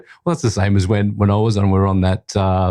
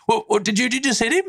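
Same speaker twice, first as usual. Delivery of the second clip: high, panicked mock alarm over the radio; alarmed assistant director; 12 semitones higher, faster than usual. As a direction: play the startled crew member on the mic. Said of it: "A Ds on their mics going".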